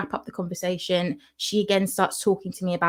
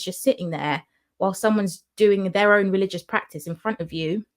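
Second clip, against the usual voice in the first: normal talking voice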